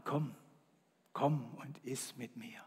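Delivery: whispered, soft invitation. Shown as a demonstration. Jesus invites to communion, whispering. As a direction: breathe it like a secret. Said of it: "Das nenne ich leise".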